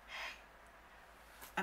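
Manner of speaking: imitated whispered hello. Friend answering the phone, whispering. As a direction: answer in a tiny hushed whisper. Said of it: "She's whispering".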